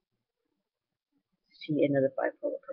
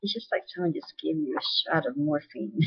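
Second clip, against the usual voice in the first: ordinary delivery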